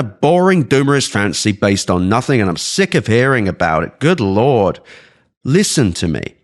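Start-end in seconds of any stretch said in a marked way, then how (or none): none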